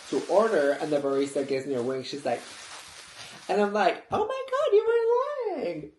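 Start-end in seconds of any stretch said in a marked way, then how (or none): 4.1-5.9 very high, slow squealed disbelief; high-pitched fangirl shriek; starstruck squeal. Retold or imitated. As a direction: squeal it, stretched out. Seeing [name] there.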